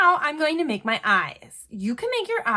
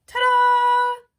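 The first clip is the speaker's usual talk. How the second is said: high, loud ta-da; excited reveal; 12 semitones higher, 6 dB louder than usual. Real, not acted. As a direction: present it like a magic trick. Reveals finished giraffe drawing.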